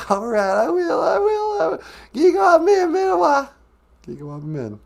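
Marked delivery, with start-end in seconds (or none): 0.1-3.5 much higher, louder silly puppet voice. Acted. Puppet asking the host one more question, high silly voice. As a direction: squeak it out like a cheeky puppet. Puppet promising, saying goodbye.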